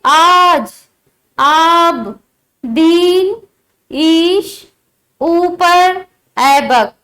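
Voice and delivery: each word stretched slowly on its long vowel in "आज", "दीन", "ऊपर"; slow, lengthened vowels, one word at a time as in "आज"; long drawn-out vowels. Shown as a demonstration. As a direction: hold the long vowel in "आब", "ईश", "ऐबक". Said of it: "थोड़ा अधिक समय लगता है".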